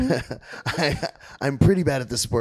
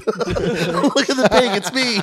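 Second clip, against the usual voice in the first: slow, laughing, higher voice; 9 semitones higher, 4 dB louder, much slower than usual. Real, not acted. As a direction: crack up while pointing it out. Laughing at the movie joke.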